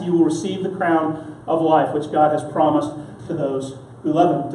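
usual speaking voice

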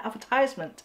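"advertisement" as ad-ver-TIZE-ment. Shown as a demonstration. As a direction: say "advertisement" with a long i. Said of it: "ไม่ชินเนอะ ต้องเป็น".

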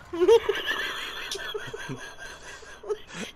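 breathless rising laugh with tears; tearful laugh. Real, not acted. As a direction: wheeze with laughter till tears come. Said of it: "ich hab immer noch die Tränen laufen".

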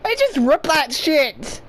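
usual speaking voice

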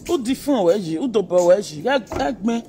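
shouting in Yoruba; loud rant. Real, not acted. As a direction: blast it at them.

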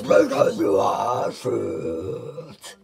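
very loud, drawn-out roar; full-blast shout. Retold or imitated. Retelling her comeback to boyfriend, roared shout. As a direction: bellow it slowly, stretching every word. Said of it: "And I was just like".

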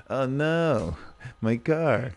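hammed-up grief; mock heartbreak. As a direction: whimper it as if your car died. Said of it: "I was like".

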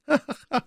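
high mocking stage laugh; ironic laugh. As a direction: laugh with high, deliberate irony. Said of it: "ein ironisches Lachen". (hohes, ironisches Lachen)

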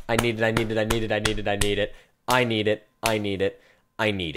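words run together in a low monotone chant; obsessive pleading. Real, not acted. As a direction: repeat it like a compulsion, without a break. Chanting his craving for the item.

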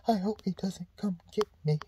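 hushed, fearful little whisper; scared whisper. Retold or imitated. Film extra hiding by the barn, scared whisper. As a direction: hide and pray he passes by. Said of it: "just like".

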